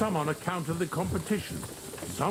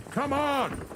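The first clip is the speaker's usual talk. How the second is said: exasperated shout; 12 semitones higher than usual. Acted. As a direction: bark at the stubborn horses to go. Yelling at stubborn horses.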